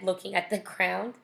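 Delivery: ordinary delivery, nothing marked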